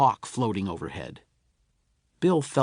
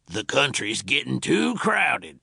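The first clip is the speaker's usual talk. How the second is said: slow, grumbling frontier father; a settler dad fed up with new neighbours; slow pioneer father complaining to the family; father's voice. A narrator's reading. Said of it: "said Bill's father".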